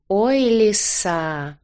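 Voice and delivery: drawn-out, doting exclamation; fondly charmed. A narrator's reading. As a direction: coo it, drawing out each word. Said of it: "умилился дракон".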